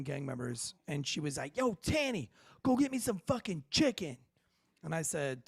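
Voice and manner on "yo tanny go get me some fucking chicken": higher-pitched imitation of a demanding woman; mock bossy demand. Woman showing off for gang members, higher bossy demand. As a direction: order someone around in a higher mock voice.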